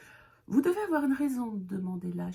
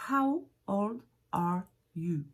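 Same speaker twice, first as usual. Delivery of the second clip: slow model question; slower than usual. Shown as a demonstration. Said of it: "vous allez demander à un enfant".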